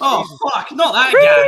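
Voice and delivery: high, loud outburst of dismay; dismayed shout. Real, not acted. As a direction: cry out in horror at the name. Groaning at an unwelcome name.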